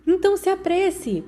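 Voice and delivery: grito agudo de Peter; gritou. A narrator's reading. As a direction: apressa eles gritando. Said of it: "Peter gritou". (grito alto e apressado)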